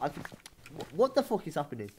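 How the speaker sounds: ordinary talk, nothing marked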